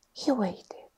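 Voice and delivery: ordinary delivery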